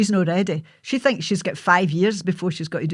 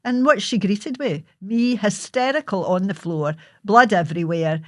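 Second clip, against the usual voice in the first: ordinary delivery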